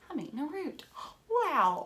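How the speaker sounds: put-on a child's high, wide-eyed wonder; a hint of a thrilled child, high and breathless; amazed kid. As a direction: play a kid discovering it. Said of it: "you'll be like".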